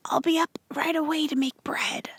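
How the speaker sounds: weak sickbed whisper; barely voiced, too ill to speak up. Sick Ma promising to get up, whispered.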